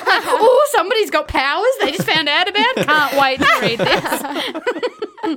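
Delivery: high, loud gushing through laughter; mock excitement. Sending up own taste for teenage fantasy books, gushing. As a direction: gush in mock delight, slowing right down at the end.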